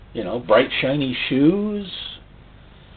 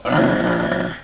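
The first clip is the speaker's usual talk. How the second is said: demon growl; 3 semitones lower than usual. Retold or imitated. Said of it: "And they go".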